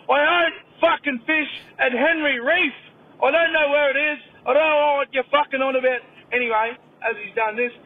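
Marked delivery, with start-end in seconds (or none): 0.1-6.0 loud, high mock exasperation; exasperated protest. Client fed up with the masseuse's question, loud mock exasperation. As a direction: blurt it out at full volume, fed up. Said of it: "He goes".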